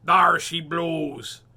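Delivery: loud, slow pirate holler; pirate version before the correction. Acted. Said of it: "Nope, that was the parrot".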